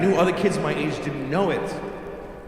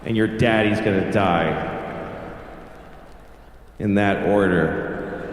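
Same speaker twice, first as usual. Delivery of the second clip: put-on menacing kid, pitched low with long pauses; cold deadpan; 8 semitones lower than usual. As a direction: deliver the bad news with cruel calm. Child coldly delivers bad news.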